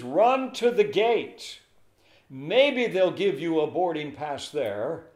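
slow, loud and high, urging her on; urgent clerk. Retold or imitated. Airport clerk urging a late traveller, slow and loud.

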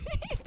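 helpless high-pitched giggle; high squealing laugh. Real, not acted. Player enjoys trolling a friend, high squealing laughter.

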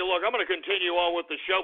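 ordinary delivery

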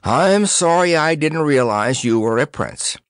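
high-pitched, contrite apology. A narrator's reading. Percy apologizing to Tatters, contrite. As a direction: apologize earnestly, sounding sorry. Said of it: "He turned contritely to Tatters".

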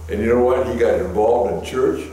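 ordinary talk

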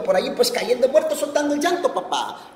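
high, loud, playful exclamation dropping on the last word; grandfather's catchphrase. Retold or imitated. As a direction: belt out grandpa's old saying, then a small laugh. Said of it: "como decía mi abuelito".

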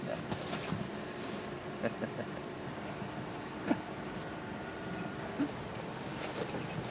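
breathy whooping hyena giggle; snarling with hyena giggles. Pretend. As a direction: cackle like a laughing hyena. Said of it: "you want me to do my hyena impersonation again".

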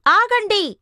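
sharp, loud call to halt; shout. Acted. Krishna yelling at friends entering the python's mouth, loud warning shout. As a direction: yell at them to stop right now. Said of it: "అని గట్టిగా అరిచాడు".

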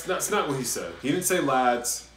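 ordinary talk

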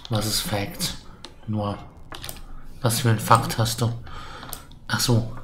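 normal talking voice

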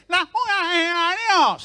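high, slow drawn-out outraged curse; splashed man swearing at the truck; mock angry curse. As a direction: curse the truck driver, stretched out. Gangoso cursing after being splashed.

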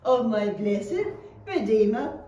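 slow teacher-led recitation, phrase by phrase; saying it together. Shown as a demonstration. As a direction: lead the children slowly through the verse. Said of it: "Let's say it together".

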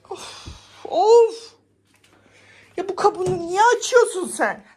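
high loud scolding; scolding the cat. Real, not acted. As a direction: tell the cat off sharply. (kediyi azarlama)

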